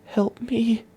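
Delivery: high, thin frightened squeak; high terrified squeak for help; dry squeak. Acted. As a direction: barely squeeze out a plea. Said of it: "A dry squeak slipped from her throat".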